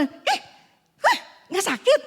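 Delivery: high, startled question; astonished friends. Retold or imitated. Church women see her walking without pain, imitated astonishment. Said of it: "Teman ibu-ibu semua".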